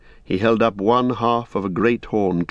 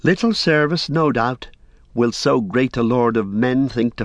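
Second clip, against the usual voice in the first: higher, stung and proud hobbit; 4 semitones higher than usual. Acted. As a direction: offer service with wounded pride. Pippin offers his service proudly.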